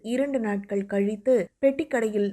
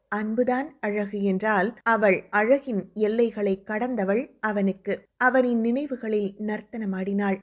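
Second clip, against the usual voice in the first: ordinary delivery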